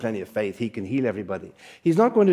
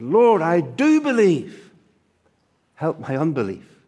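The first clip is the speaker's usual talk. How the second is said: desperate cry; 3 semitones higher, 3 dB louder than usual. Retold or imitated. Father crying out to Jesus for his son, loud pleading cry. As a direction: lift the voice with a lifetime of grief. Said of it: "the man lifts up his voice with all the power and all the longing".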